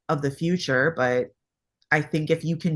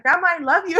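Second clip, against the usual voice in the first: put-on high, sing-song little girl, much higher; child's voice; 13 semitones higher, 4 dB louder, faster than usual. Mother imitates daughter hugging grandma in a high child's voice. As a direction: gush like a small child showing off her grandma. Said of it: "she's like".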